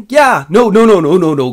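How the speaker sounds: loud, high burst; hasty mock refusal. Elf lady is offered the ring, loud flustered refusal. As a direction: rattle out emphatic refusals.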